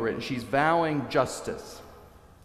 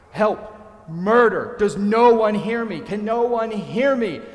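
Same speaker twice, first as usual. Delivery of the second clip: high, loud desperate cries, slowing at the end; crying out for help; 9 semitones higher, 8 dB louder than usual. A narrator's reading. Wounded lover crawling across the stage, crying out for help. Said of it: "he's crying out".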